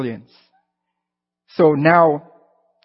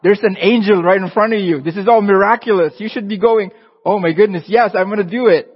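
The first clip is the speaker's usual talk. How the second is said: higher and louder, a mock-stern scolding God; playful voice of God; 4 semitones higher, 6 dB louder than usual. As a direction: boom it out like an impatient God chiding Gideon. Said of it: "God is probably going".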